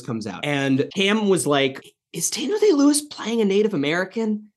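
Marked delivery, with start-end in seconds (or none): 2.1-4.5 high-pitched mock disbelief; puzzled question. Roommate walks in on the movie, puzzled. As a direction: imitate a baffled roommate, voice pitched up.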